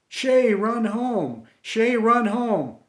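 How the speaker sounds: high, loud, slow chanting scream; the whole crowd urging him home. Retold or imitated. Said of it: "ran behind him screaming".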